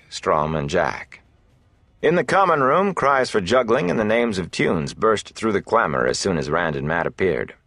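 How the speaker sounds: usual speaking voice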